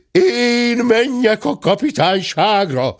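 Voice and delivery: high, slow howl of protest; outraged howl. A narrator's reading. Gentleman refusing to go to the police, outraged howl. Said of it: "üvöltötte dörmögő úr".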